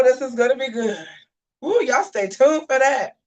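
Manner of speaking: usual speaking voice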